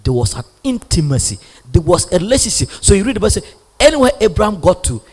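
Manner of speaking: loud, driving sermon voice; fiery preaching. Acted. Pastor urging the congregation to know God's voice, full force.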